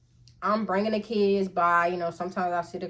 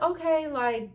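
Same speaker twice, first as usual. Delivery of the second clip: unbothered; 8 semitones higher than usual. Retold or imitated. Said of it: "I guess he thought I was gonna".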